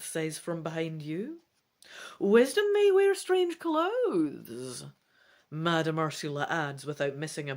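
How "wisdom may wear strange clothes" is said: put-on elderly shopkeeper voice. A narrator's reading.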